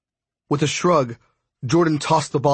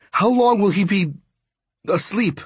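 panicked; 8 semitones higher than usual. Acted. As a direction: let panic leak into the voice. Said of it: "I hated the panic in my voice".

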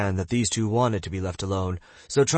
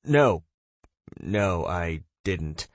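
dejected; much slower than usual. A narrator's reading. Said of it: "Dale dejectedly replied".

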